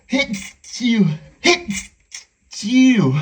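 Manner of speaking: two loud achoos; big double sneeze. Acted. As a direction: let the sneezes out. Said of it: "I'm gonna sneeze again".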